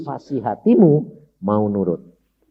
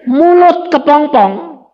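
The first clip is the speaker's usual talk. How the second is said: emphatic preaching; 11 semitones higher, 6 dB louder, slower than usual. Real, not acted.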